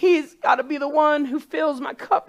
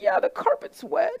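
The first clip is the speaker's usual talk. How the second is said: ordinary talk, nothing marked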